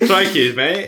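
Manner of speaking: playful Aussie catchphrase; mock Australian accent. Echoes the Australian accent demo.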